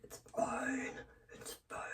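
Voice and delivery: fading to a broken whisper; whispered "it's fine". Real, not acted. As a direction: hush it out, almost crying. Fading self-reassurance, cry.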